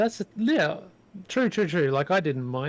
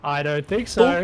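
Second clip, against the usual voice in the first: slow taunting; 7 dB louder than usual. Real, not acted. Taunting monster, slowed.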